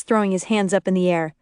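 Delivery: usual speaking voice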